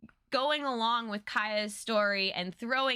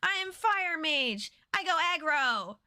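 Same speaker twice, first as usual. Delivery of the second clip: higher, blunt caveman voice; mocking Chandra as a hotheaded caveman; dumb brute Chandra; 5 semitones higher than usual. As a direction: play her as a simple-minded brawler.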